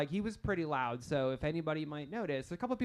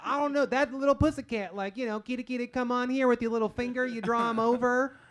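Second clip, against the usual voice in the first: put-on Southern boy accent; 7 semitones higher, 3 dB louder than usual. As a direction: coax the kitty along, higher. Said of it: "He's like".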